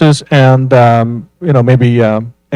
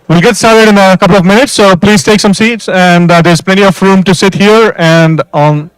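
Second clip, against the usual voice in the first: raised, higher announcing voice; projecting to a crowd; 6 semitones higher, 5 dB louder than usual. Real, not acted. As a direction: project over the chatter. Host addressing crowd over noise.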